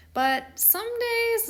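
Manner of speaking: high, slow, foreboding; sudden high dramatic turn. A narrator's reading. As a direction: build suspense up high. Ominous story turn.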